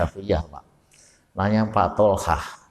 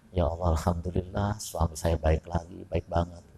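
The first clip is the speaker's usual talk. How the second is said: soft, sincere thanks, much quieter; grateful wife; 5 semitones lower, 10 dB quieter than usual. Pretend. Wife thanking God softly for her husband's generous allowance. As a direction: murmur thanks like a content wife.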